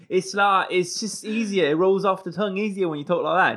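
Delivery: easy, rolling East London accent. Shown as a demonstration. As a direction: slip back into matey London slang accent. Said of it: "Well, I went back to".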